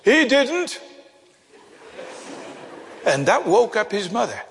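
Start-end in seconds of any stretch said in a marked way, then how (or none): 0.0-0.8 retold boy's shout. Little boy corrects the preacher from his seat, mock shout. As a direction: imitate a little boy calling out in protest. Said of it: "shouted at the top of his voice".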